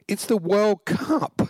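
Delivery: slow, rising mock-innocent line; high, drawn-out quote. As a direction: stretch it out, high and amused. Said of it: "She said".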